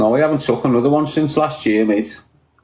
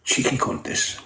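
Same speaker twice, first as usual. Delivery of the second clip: voiceless muttered aside; whispered insult; 8 dB quieter than usual. Real, not acted. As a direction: breathe it out under your breath.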